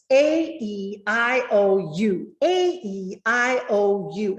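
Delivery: crisp, over-articulated "A, E, I, O, U"; vowel drill. Shown as a demonstration. Teacher demonstrating a warm-up for students, exaggerated articulation. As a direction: stretch each vowel with wide mouth. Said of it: "try a very simple articulation exercise".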